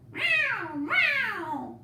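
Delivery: pleading cat meow; hungry cat meow. Acted.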